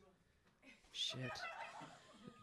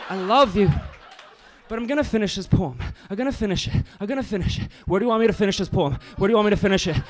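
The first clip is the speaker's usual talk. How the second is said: higher, louder, rushing insistent build; hammed-up excitement; 6 semitones higher, 5 dB louder, faster than usual. Slam poet rushing toward the end of the poem. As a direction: drive it home, pushing to finish.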